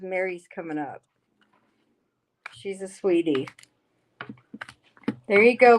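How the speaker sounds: normal talking voice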